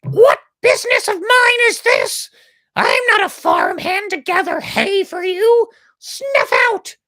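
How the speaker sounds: very high, loud, scornful squawk; shrill proud chick brushing the spark off; haughty half-chick. A narrator's reading. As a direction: sneer down at the spark. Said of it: "replied Medio Pollito".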